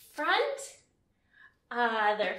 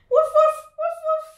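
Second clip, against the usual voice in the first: much higher, louder puppy barks; high dog barking; 10 dB louder, faster than usual. Pretend. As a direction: yip like a little puppy. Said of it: "this first pose sounds like this".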